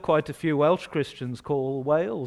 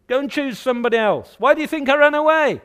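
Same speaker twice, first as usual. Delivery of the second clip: high-pitched, louder acted retort; exasperated refusal; 8 semitones higher, 4 dB louder than usual. Preacher as Jonah refusing God's errand, exasperated retort. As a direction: push the job away, voice raised.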